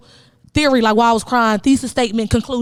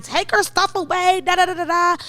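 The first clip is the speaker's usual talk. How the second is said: strict grandparents; 5 semitones higher than usual. Retold or imitated. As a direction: hand down the punishment like a stern grandparent. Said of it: "my grandparents would be like".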